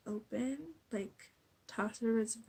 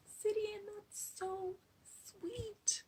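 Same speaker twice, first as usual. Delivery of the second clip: much higher, very slow, melting whisper; gushing aww; 12 semitones higher, much slower than usual. Retold or imitated. Vlogger reacting to Sidian's sweet words, melting coo. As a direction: coo it like your heart is melting.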